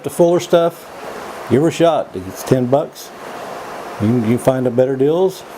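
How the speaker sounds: ordinary delivery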